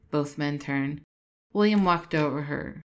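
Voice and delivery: usual speaking voice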